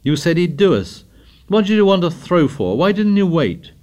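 high-pitched, heated accusation; flushed reproach. A narrator's reading. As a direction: demand answers, pitch climbing. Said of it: "Jack flushed suddenly".

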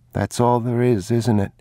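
put-on a small boy fretting aloud; boy's voice. A narrator's reading. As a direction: ask it as an anxious child.

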